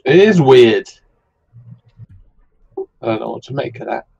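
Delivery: normal talking voice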